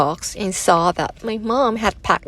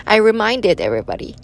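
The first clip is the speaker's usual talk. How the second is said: ordinary delivery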